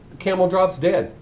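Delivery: ordinary talk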